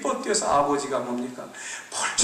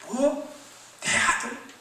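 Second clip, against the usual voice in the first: surprised; 11 semitones higher, much slower than usual. Acted. Father hears the news.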